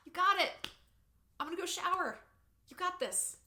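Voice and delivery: high, light, hands-off encouragement; airy cheerleader reassurance; breezy sideline supporter. Acted. Acting supportive partner on the sidelines.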